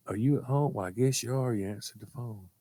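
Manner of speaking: subdued, softly voiced mother; quiet, low-energy phone voice. Mother rambling to her grown child on the phone, subdued mother voice. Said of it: "she'd start talking".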